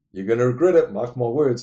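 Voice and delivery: loud mock warning; warning threat. Guy warning Van Damme he'll regret staying, loud threat. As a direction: bellow the threat at him.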